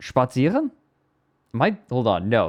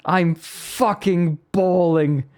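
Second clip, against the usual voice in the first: mock bawling; 8 semitones higher, slower than usual. Repeats the clip's crying voice.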